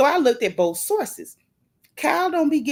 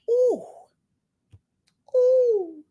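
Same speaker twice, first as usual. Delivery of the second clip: high scandalized ooh; 13 semitones higher than usual. Real, not acted. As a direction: gasp at the gossip. Reacting to scandal.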